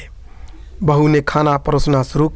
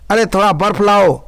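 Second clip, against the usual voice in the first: high, loud call for ice; 5 semitones higher, 4 dB louder than usual. Acted. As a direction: shout an order across the room. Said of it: "उसका पति चिल्लाता". (पति का ऊंची आवाज़ में पुकारना)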